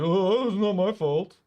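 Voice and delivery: slow, mocking whine of the neighbour; whiny excuse. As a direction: drag it out like a sulky excuse. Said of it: "So he's like".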